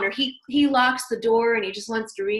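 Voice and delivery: ordinary talk, nothing marked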